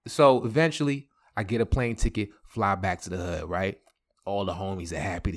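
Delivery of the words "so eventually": ordinary talk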